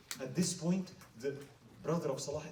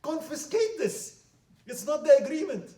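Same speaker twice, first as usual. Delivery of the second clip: disgusted demand; 12 semitones higher, 11 dB louder than usual. Acted. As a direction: demand the wealth be seized, loud and high. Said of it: "He was so disgusted of this person".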